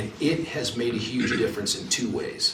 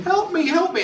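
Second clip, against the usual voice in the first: high-pitched mock cry for help; mock yelling. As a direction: imitate a panicked suspect pleading. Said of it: "he's yelling".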